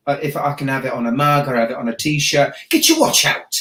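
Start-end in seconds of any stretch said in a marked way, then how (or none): 2.7-3.5 very high punchy catchphrase. Shown as a demonstration. Host showing the mug slogan, very high and punchy.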